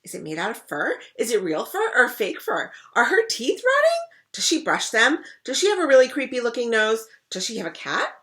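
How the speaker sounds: rapid, high, loud eager questioning; excited questions. Acted. Rascal pestering her siblings with eager questions, high and loud. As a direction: fire off questions bursting with curiosity.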